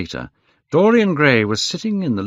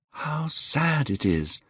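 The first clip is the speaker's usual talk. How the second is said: slow, soft, higher-pitched lament; sad musing; 7 semitones higher, 5 dB quieter, slower than usual. A narrator's reading. Dorian staring at his own portrait, sad and slow. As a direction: draw it out softly, sorrowfully.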